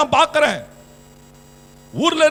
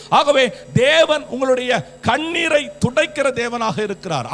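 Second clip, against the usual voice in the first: ordinary talk, nothing marked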